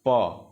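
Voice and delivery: पा popped firmly from both lips; lip sound demo. Shown as a demonstration. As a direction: say प with a clear lip burst. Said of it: "एक बार उच्चारण करके देखो".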